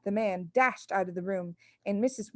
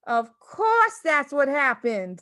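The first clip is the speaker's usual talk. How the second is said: crying out; 5 semitones higher, 6 dB louder, slower than usual. A narrator's reading. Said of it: "the mother cried".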